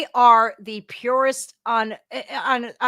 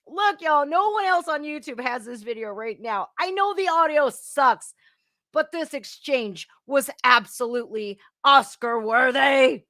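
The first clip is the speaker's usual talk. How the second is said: usual speaking voice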